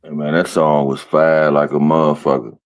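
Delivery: normal talking voice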